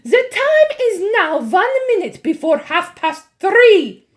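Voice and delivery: high, loud, grand witch proclamation; witch announcement. A narrator's reading. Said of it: "announced the Grand High Witch".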